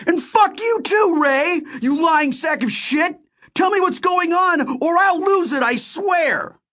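very high, enraged yelling; furious shout. A narrator's reading. Narrator curses Ray for lying, shouting furiously. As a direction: shout it as someone about to lose it. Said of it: "I shouted".

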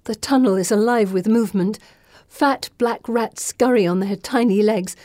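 ordinary delivery

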